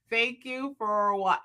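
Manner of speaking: slow, stretched, awkward phrase; unnaturally drawn-out slow attempt; attempted slow delivery. Shown as a demonstration. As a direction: force each word out slowly. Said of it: "it sounds crazy coming out".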